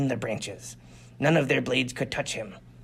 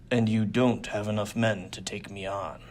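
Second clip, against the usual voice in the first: dangerously low; 6 semitones lower, 3 dB quieter than usual. Acted. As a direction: drop the voice into a low threat. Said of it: "His voice dropped dangerously low".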